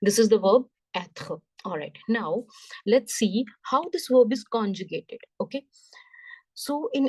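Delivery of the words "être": être as "et-khuh", with a throaty r; French pronunciation of être. Shown as a demonstration. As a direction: say être with a soft t and guttural r. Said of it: "So the sound is être".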